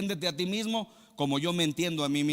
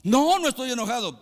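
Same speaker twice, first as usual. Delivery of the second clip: higher, louder huffy denial that gives itself away; irritated denial; 7 semitones higher, 5 dB louder than usual. Retold or imitated. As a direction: deny it with an annoyed little tone. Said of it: "nada más el tonito con lo que lo dice".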